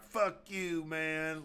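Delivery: high mock defiance, tossed off; mock defiant defender. Defenders answering the warning to leave, high and defiant. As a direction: sneer back like a cocky drunk. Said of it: "They're like".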